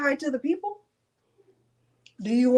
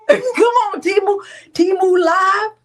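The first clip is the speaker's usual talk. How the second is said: laughing call; 9 semitones higher, 10 dB louder than usual. Real, not acted. Laughing call to the live stream.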